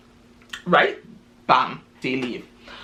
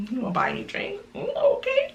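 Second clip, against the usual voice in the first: coy, sing-song rise on okay, pitched much higher; flirty; 4 dB quieter than usual. Retold or imitated. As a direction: play it sweet and teasing, she's warming up. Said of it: "She started to smile up".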